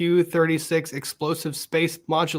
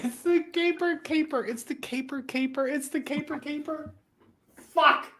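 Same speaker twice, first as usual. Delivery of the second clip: laughing; 9 semitones higher, 5 dB quieter than usual. Real, not acted. Repeating pun while laughing.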